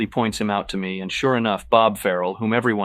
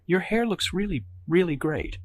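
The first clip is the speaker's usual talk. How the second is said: placating; 10 semitones higher than usual. Acted. Evelyn placating worriedly.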